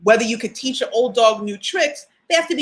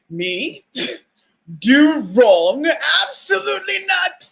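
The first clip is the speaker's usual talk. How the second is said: haughty mock indignation, laugh breaking in; perfection voice; 4 semitones higher than usual. To her viewers, the inner voice refusing blame, haughty mock. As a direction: play the ego that never admits fault. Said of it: "that's, like, my perfection voice".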